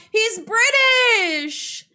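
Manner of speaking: high, loud, drawn-out cry; excited exclamation. Acted. Reacting to the player's British accent, loud excited exclamation. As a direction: blurt it out high and stretch the last word.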